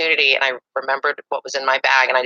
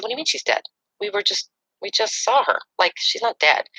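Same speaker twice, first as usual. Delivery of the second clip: much higher, stunned denial; shocked disbelief; 10 semitones higher than usual. Retold or imitated. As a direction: refuse to believe the news. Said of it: "And I said".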